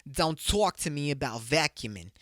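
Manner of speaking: put-on slow, drawn-out New Jersey accent, quoting a movie line; deliberate New Jersey movie impression. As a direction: lean into the New Jersey vowels, slowly. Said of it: "my fucking New Jersey accent would have been that good".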